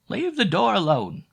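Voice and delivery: put-on high, loud scolding old woman. A narrator's reading. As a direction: snap at her like a cross old landlady. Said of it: "I heard the old woman say".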